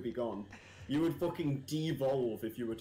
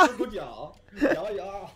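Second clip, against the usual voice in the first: louder, higher mock German accent; 5 semitones higher, 9 dB louder than usual. Friend teases streamer as German, mock German accent. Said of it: "someone who's moved over from Germany".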